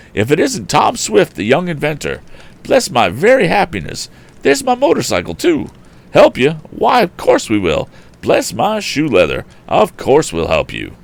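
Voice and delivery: put-on high-pitched, fussy eccentric old gentleman exclaiming; excitable Mr Damon. A narrator's reading. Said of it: "exclaimed the odd gentleman".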